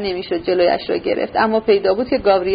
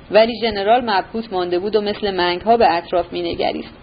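ordinary delivery, nothing marked

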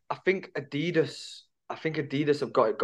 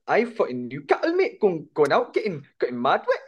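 higher, sing-song mock Geordie; caricature Geordie accent; 4 semitones higher than usual. Shown as a demonstration. Showing their caricature when doing that accent, mock Geordie. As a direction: put on a cartoon Geordie accent, up and down. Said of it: "the caricature when I'm, when I'm doing that".

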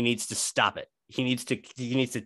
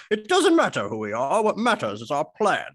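put-on movie-villain voice, pitched up; Bane; 3 semitones higher than usual. Podcast host likening a player to a film villain, Bane impression. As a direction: play the masked villain, higher than your own voice. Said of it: "he's like Bane at the start of The Dark Knight Rises".

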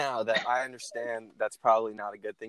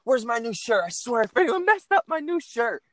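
irritated Steve voice; 9 semitones higher than usual. Retold or imitated.

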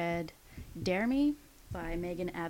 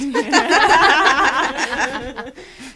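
loud burst of laughter; 8 semitones higher, 21 dB louder than usual. Real, not acted. Laughs at pillow fights.

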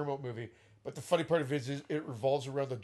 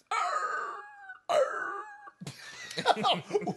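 walrus noise. Acted. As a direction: bellow like a walrus. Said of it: "That was my walrus noise".

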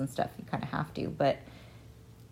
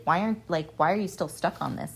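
usual speaking voice

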